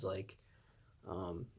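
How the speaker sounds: normal talking voice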